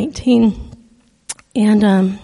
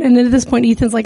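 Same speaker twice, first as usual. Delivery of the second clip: ordinary talk, nothing marked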